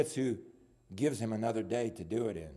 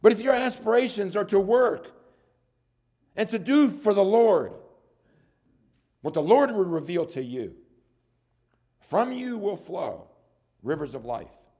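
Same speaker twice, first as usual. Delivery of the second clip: high, loud pulpit climax; forceful preaching; 9 semitones higher, 7 dB louder than usual. Acted. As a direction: press it home to the congregation.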